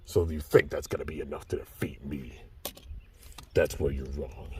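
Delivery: low menacing villain taunt. Acted. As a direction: gloat softly and menacingly.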